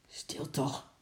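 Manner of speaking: soft, embarrassed shushing; hushed scolding; hushing. A narrator's reading. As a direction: shush a noisy sister under your breath. Embarrassed sister shushes noisy Lotje.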